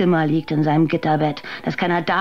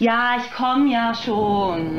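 weary reply; 6 semitones higher, much slower than usual. Acted. Waitress answers taunting guests.

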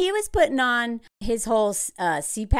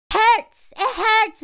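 high, loud hammed-up wail of pain; mock wail; 10 semitones higher, 6 dB louder, much slower than usual. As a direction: whine it like an exaggerated heartbroken girl. Mocking a contestant's heartbreak.

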